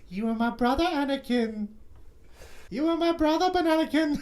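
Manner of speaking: high, loud mock anguish shout; Obi-Wan impression. Cohost shouting Obi-Wan's lament, hammed up. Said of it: "Ewan McGregor".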